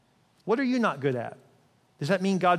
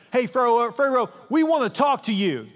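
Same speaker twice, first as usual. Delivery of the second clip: calling out to Pharaoh; 6 semitones higher, 5 dB louder than usual. Acted.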